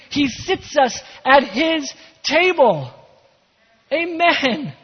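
high, loud, fired-up pulpit delivery; rousing proclamation, key words stretched out; excited preaching. Real, not acted. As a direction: build to a big amen moment. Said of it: "a little bit more excitement, because that's a big amen moment".

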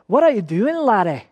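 best attempt at a Scottish accent; lilting amateur Scottish brogue. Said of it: "That's my, my best Scottish accent".

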